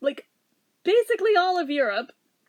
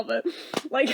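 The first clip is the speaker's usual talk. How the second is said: ordinary talk, nothing marked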